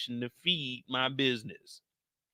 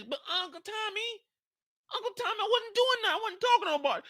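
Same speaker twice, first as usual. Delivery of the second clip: put-on much higher pleading boy's voice; whiny kid voice; 4 dB quieter than usual. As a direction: plead like a scared kid caught out. D-Mac pleads with uncle.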